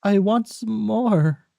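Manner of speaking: put-on high, pleading child voice; Oliver Twist attempt. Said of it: "I can't do it".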